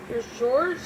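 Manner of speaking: plain reading voice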